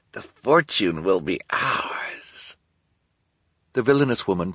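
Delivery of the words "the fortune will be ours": slow sinister whisper. Acted. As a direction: hiss it greedily under breath. Esme whispering triumph.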